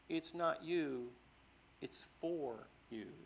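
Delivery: slow, soft "it's for you" with "for" drawn out; stress on for. Shown as a demonstration. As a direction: lean on "for" gently. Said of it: "he emphasized the word "for".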